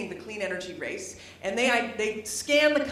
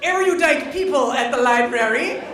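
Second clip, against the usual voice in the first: loud, high, laughing outburst; real laughing; 8 semitones higher, 9 dB louder than usual. Real, not acted. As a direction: laugh it out at the crowd. Laughing at audience's silence.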